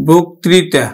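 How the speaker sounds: বক্তৃতা said whole with বো for the first vowel; model pronunciation. Shown as a demonstration. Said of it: "আমরা উচ্চারণ করব কিন্তু".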